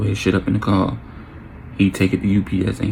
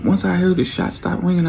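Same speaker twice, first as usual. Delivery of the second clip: ordinary delivery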